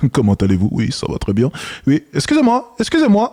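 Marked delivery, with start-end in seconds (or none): 1.9-3.3 jumps way up into a squeaky high greeting; imitating a nervous guy's high-pitched voice break; mock voice crack. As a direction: mimic his voice flipping high mid-approach. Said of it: "Regardez la transition".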